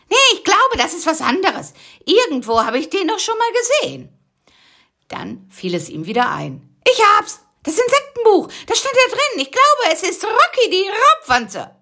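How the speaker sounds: high, loud eureka; excited discovery. Acted. Busy recognising the bug, high excited shout. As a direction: burst out with the answer.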